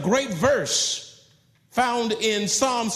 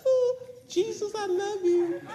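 mock worshipper; 13 semitones higher than usual. Mocking a voice praising Jesus.

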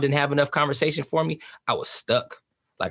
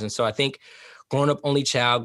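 ordinary talk

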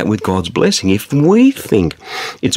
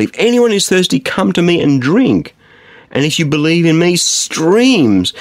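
Jesus calling out; 6 semitones higher than usual. Acted. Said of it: "said in a loud voice".

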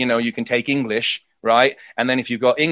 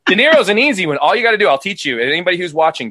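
ordinary delivery

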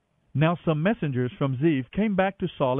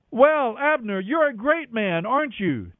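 taunting; 8 semitones higher than usual. A narrator's reading. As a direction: needle him with fake praise. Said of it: "David taunted".

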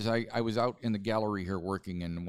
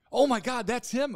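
much higher, louder gasp of recognition; retold excited recognition; 6 dB louder than usual. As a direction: play the coworkers suddenly realizing who it is. Retold coworkers recognizing the photo.

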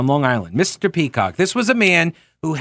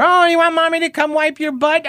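mocking teacher impression; 12 semitones higher than usual. Teacher mocks an excuse-making kid, high and taunting. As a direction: taunt like Mister Peacock, pitched way up. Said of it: "this is what he would do to us".

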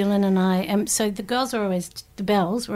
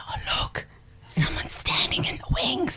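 stage whisper; 10 semitones higher, 6 dB quieter than usual. Retold or imitated. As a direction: hiss it so the whole theatre hears. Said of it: "I had a terrible stage whisper".

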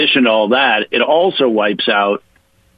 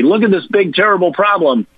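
higher-pitched mock alarm; 8 semitones higher than usual. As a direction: feign alarm as a political opponent would. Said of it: "they're gonna say".

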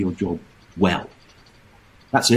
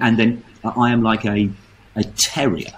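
ordinary talk, nothing marked

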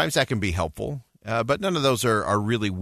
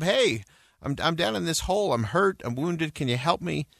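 higher-pitched appeal at everyday volume; mild calling out; 8 semitones higher than usual. A narrator's reading. Trapped man calls to a passing clergyman, mild calling out. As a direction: plead upward, lightly acted. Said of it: "The guy shouts up".

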